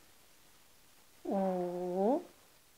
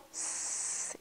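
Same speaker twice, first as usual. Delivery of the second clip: « s » soufflé longuement, sans voix; sifflement de serpent; 12 dB quieter than usual. Shown as a demonstration. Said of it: "Pensez au serpent". (son de serpent, chuchoté)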